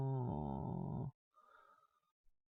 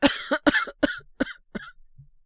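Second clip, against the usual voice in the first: harsh feverish cough; loud rasping sickbed cough; 12 semitones higher, 10 dB louder than usual. Acted. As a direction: cough hard from a sore chest. Sick boy in bed.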